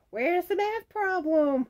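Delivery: high, loud comic voice; catchphrase parody. Acted. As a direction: belt out the slogan in a high put-on voice. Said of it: "Instead of "Where's the beef?".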